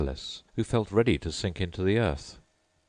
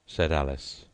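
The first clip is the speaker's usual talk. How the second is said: ordinary delivery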